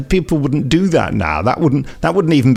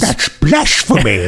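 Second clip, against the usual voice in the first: mock outrage; slower than usual. Mock outrage at liking new Crow Man.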